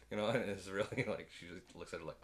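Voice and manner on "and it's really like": usual speaking voice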